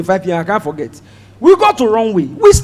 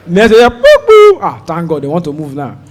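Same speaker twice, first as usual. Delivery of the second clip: ordinary talk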